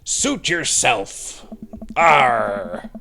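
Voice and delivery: put-on slow pirate drawl in a pirate accent, loud arr; hammed-up pirate accent, slow then a loud arr. Pirate accent as she shrugs off a refusal to down the shot. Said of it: "the pirate".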